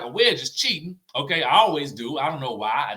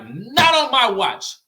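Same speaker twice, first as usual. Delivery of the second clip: loud, high, drawn-out refusal; acted-out firm, raised-voice objection; indignant retort; 8 semitones higher, 8 dB louder, slower than usual. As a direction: put your foot down, slow and emphatic.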